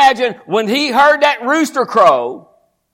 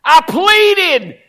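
louder, higher earnest pleading as Jesus; 5 semitones higher, 6 dB louder than usual. Acted. Preacher voicing Jesus pleading for Peter, loud and high. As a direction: cry out the prayer over Peter.

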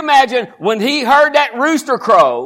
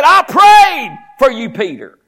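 louder, higher earnest pleading as Jesus; 5 semitones higher, 6 dB louder than usual. Acted. Preacher voicing Jesus pleading for Peter, loud and high. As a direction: cry out the prayer over Peter.

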